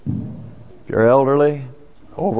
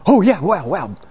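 eager yes; 4 semitones higher than usual. Retold or imitated. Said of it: "would call upon us and say".

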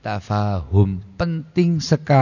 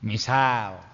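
normal talking voice

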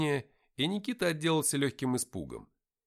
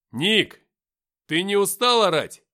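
loud, raised-pitch holler; shouting; 7 semitones higher, 11 dB louder, slower than usual. A narrator's reading. Narrator to Nikita, tired of his yelling, shouting. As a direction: shout it up at a noisy friend. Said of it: "крикнул я".

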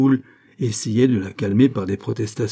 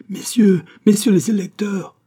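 high, loud cry for help; strident, desperate; 11 semitones higher, 6 dB louder than usual. A narrator's reading. Bailly summoning the electors, strident and desperate. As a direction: call out urgently at a much higher pitch. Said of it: "d'une voix stridente, désespérée".

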